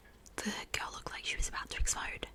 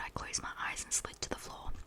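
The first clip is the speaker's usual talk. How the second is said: usual speaking voice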